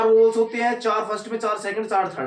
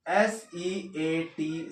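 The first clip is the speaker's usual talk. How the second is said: slow spelling; 3 semitones lower, 6 dB quieter, much slower than usual. Shown as a demonstration. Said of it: "spelling out seat".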